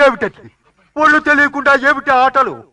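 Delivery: high-pitched outraged rebuke; furious, high-voiced telling-off; angry scolding. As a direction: scold the revellers at the top of your range. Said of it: "కోపం".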